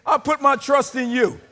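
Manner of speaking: emphatic declaration. Acted. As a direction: declare it forcefully to the whole church. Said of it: "he emphatically declares".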